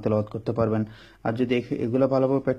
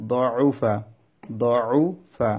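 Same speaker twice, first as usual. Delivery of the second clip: দউফা very slow, long উ, then a separate ফা; slow syllables; much slower than usual. Shown as a demonstration. Teacher demonstrating a hard word, slow syllables. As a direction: stretch দউফা out for learners.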